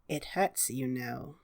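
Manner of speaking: low, soft, menacing hag; menacing hag whisper. Acted. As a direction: lean in and threaten softly. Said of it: "she says".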